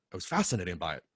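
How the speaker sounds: normal talking voice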